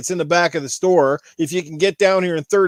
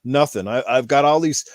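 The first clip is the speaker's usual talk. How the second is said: ordinary delivery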